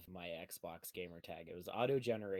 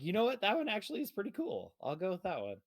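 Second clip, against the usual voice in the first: put-on excited kid voice; 10 semitones higher, 3 dB louder, faster than usual. Voicing his kid self choosing.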